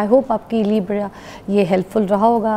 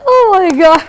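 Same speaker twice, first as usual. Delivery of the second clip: amazed exclamation; 8 semitones higher, 9 dB louder, much slower than usual. Real, not acted. Host stunned by impression.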